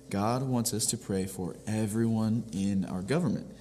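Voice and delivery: ordinary talk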